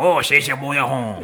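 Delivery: drawn-out fake Cajun; mock Cajun accent. Podcaster showing how people imitate Cajun badly, mock Cajun accent. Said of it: "It's like Cajun. Like, people can be like".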